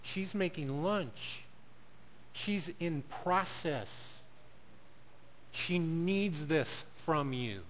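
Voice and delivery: soft and slow, patiently setting him right; God gently correcting the husband, soft and slow; gentle divine correction. Retold or imitated. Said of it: "And God said".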